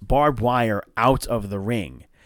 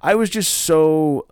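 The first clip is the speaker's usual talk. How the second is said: usual speaking voice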